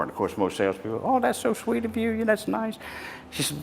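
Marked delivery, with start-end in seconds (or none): 1.0-2.8 high, soft gushing sales voice; gushing saleswoman. Retold or imitated. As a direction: coo it like a sugary shop clerk. Sweet-talking saleswoman.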